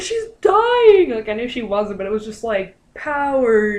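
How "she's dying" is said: much higher, louder mock alarm; mock panic. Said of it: "And I was like".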